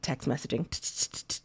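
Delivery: hushed unvoiced aside, slow; slow whispered announcement. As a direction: breathe it like a stage direction. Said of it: "announcing the dramatized reading".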